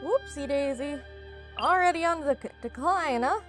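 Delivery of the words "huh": ordinary talk, nothing marked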